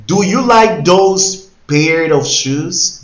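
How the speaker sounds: very slow, loud and high, every word laid out; teacher modelling a question very slowly; slow model question. Shown as a demonstration. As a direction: spell the question out slowly for learners. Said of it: "teacher models question".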